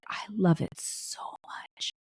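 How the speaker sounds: soft slow whisper, hugging a cherished toy; whispered lovingly. Retold or imitated. Son whispers to his mom about his beloved gift, slow whisper. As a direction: breathe it out like a treasured secret.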